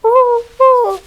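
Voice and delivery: high, loud hooting like an owl. Acted. Child presenting the owl opens with a hoot.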